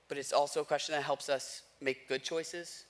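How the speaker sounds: usual speaking voice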